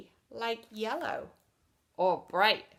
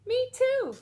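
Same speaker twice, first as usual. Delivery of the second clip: very high, louder, eager squeak; brave Yellow; 9 dB louder than usual. Acted. Yellow joining One, high eager voice. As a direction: chirp it up high and bold. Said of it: "Yellow felt brave and said".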